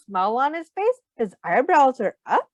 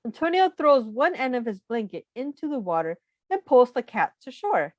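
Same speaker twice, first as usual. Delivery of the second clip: ordinary delivery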